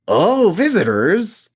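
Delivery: loud, high, thrilled "Oh, visitors!"; excited orc. Acted. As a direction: burst out with delight. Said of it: "and the orc goes".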